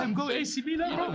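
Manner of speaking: very high-pitched, excited retold boast; excited announcement. Player telling his friends about Milan, excited. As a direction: squeal the big news to your mates.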